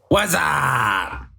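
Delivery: yelled into the mic; shouting. Real, not acted. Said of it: "literally just yelled".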